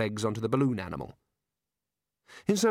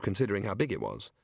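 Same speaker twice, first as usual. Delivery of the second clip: ordinary delivery, nothing marked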